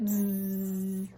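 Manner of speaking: teasing buzzy monkey reply; mock them with a buzz. Monkeys buzzing back at the peddler.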